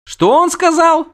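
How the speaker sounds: very high, loud question; curious siren. A narrator's reading. Siren wants the insult translated, curious. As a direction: ask eagerly, pitch shooting up. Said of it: "заинтересовалась Шьенас".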